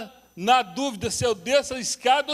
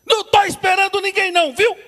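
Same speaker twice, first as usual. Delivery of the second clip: loud retort; 11 semitones higher, 12 dB louder than usual. Retold or imitated. Wife answering loudly from bedroom.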